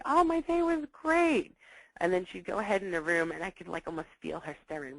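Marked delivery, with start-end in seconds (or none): 0.1-1.6 much higher, louder, slow sing-song brush-off; mock cheerful. Daughter fobbing off her mother after school, sarcastic sing-song. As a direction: say it sweetly while obviously not meaning it.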